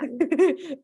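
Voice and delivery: high bright laugh at her own joke; real amused laugh; burst of laughter. Real, not acted. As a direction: laugh out loud.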